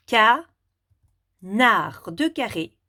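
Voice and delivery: "canard" broken apart with a long gap, ca... nard; découpage en syllabes. Shown as a demonstration. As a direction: count out "canard" as two separate syllables. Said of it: "pour chaque syllabe dans le mot". (« canard » en deux syllabes bien séparées : ca… nard)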